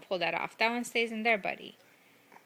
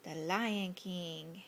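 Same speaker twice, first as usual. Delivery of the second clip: put-on slow naming for baby; 3 semitones lower, 6 dB quieter, much slower than usual. Shown as a demonstration. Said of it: "What's on T V?".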